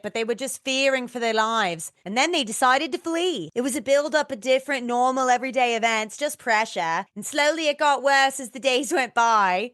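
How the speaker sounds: a mock Australian accent that slides toward Cockney. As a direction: quote Mitchell in a bad Australian accent. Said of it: "That's my Australian accent".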